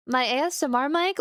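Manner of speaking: usual speaking voice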